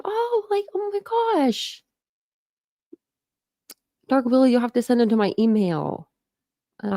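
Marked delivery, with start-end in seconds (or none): 0.0-1.8 real high-pitched gushing "oh"; delighted surprise. Real, not acted. Reacting to news of a grandson.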